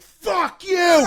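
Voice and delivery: high, loud yell at another driver; high-pitched retold road rage yell; road rage shout. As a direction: let rip an outraged roar out the window.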